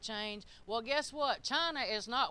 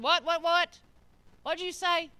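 mock surprise; 5 semitones higher, 7 dB louder than usual. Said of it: "I said".

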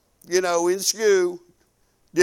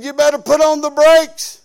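playful warning; 5 semitones higher, 7 dB louder than usual. Retold or imitated. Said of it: "I said".

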